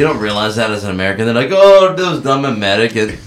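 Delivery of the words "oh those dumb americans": put-on louder, high-pitched botched Irish accent; hammed-up Irish accent that misses badly; terrible Irish accent. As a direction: do a bad, unplaceable mock Irish accent. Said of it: "that was not an Irish accent".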